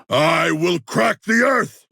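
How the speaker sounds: slow, high roaring battle shout. Acted. Shark warrior to enemies, slow high battle shout. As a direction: bellow it as you strike the ground.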